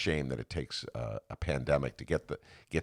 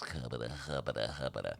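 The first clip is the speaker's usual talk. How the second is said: stammering nonsense; 3 semitones lower, 7 dB quieter than usual. Retold or imitated. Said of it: "I was".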